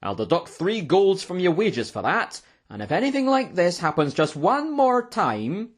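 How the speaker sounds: high, loud scolding innkeeper; angry shouting. A narrator's reading. Innkeeper threatening the waiter's wages, angry. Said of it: "shouted MacFiber".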